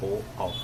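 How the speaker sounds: ओ, आ softly, with rounded lips. Shown as a demonstration. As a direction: round the lips for a low, soft ओ, आ. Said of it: "ओठ गोला बनाएंगे". (गोल ओठों से धीमे ओ, आ)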